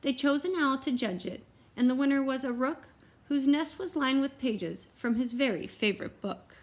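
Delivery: ordinary delivery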